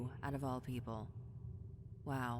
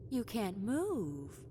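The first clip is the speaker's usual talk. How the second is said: slow taunt; 3 semitones higher, much slower than usual. Acted.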